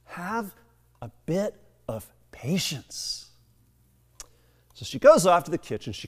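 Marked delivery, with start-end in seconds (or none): 0.0-3.3 hushed, whispery acted plea for calm; soft, broken-up whisper with pauses; frazzled mom. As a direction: play a frazzled mother, voice dropped to a strained whisper. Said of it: "Her mom was kind of frazzled".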